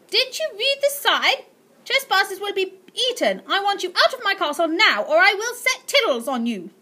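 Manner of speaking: put-on high, loud old lady threat; shrill threatening aunt. Aunt Greta ordering the boy out, shrill and loud. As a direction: screech the warning at him.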